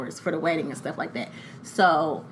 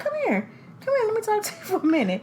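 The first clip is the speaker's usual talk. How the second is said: very high, coaxing mock-sweet voice; nice and smooth; 12 semitones higher, 4 dB quieter, faster than usual. Mother coaxing the boy to talk, fake nice. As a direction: lure a child over with put-on niceness. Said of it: "trying to be nice and smooth".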